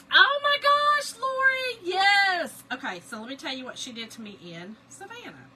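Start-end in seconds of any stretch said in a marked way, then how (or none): 0.1-2.6 high, loud excited squeal. Real, not acted. Answering viewer Lori, squealing with excitement. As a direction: shriek it in delighted agreement, stretched out.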